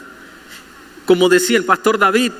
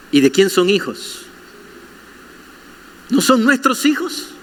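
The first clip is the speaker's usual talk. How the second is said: ordinary delivery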